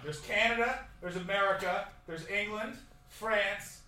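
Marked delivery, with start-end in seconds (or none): none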